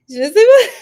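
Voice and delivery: high, loud, laughing admission. Real, not acted. Streamer stuck for French words, laughing helplessly. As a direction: let it burst into a laugh.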